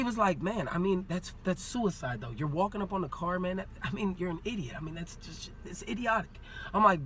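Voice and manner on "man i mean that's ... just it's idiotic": quietly write someone off as foolish; hushed mock reproach; retold dismissive officer. Officer's dismissive talk, acted out.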